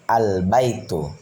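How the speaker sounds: Al-baitu with a clearly sounded l; slow Al-baitu, voicing the lam before the b; alif lam pronounced. Shown as a demonstration. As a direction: say the "Al" of Al-baitu in full. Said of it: "alif lamnya dibaca".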